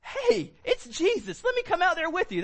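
high, eager calling out; childlike excitement. Acted. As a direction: beg to join in like a thrilled child. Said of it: "It feels like a little child".

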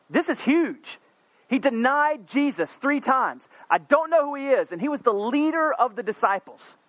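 preacher lifting to a high emotional peak; rising passion. Real, not acted. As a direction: voice climbs high with intensity. Preacher builds to emphasis.

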